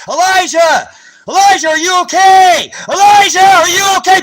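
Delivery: high, loud shout for his son; father screaming to find his son after tear gas; calling out frantically. Retold or imitated. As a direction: yell the name across the yard, desperate.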